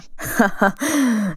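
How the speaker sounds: short chuckle; light scoffing laugh; amused laugh. Acted. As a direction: laugh off the boast. Hero laughs at her boast.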